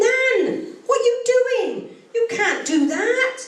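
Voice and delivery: high, aghast granddaughter; appalled, pitched right up; shocked protest. Acted. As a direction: cry out high in scandalised disbelief. Joke: shocked granddaughter confronting her nan.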